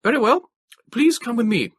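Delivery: put-on young trooper giving brisk orders, loud and high. A narrator's reading. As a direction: snap it out like a young officer. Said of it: "He said briskly".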